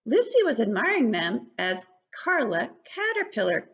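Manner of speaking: ordinary delivery, nothing marked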